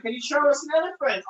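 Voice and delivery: ordinary talk, nothing marked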